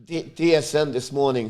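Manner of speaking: ordinary delivery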